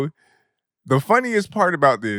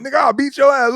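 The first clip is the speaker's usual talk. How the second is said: higher, louder mock threat; imitating a friend's threat, pitched up; threatening; 11 semitones higher, 5 dB louder, faster than usual. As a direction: threaten him. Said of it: "He's like".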